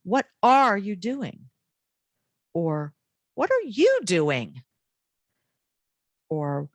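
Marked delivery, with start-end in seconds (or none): 0.0-1.5 are stretched high and loud; slow question leaning hard on are; stress on are. Shown as a demonstration. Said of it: "Or even if I said". 3.3-4.6 you lifted high and loud; slow question leaning on you; stress on you. Shown as a demonstration. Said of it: "place the emphasis somewhere else".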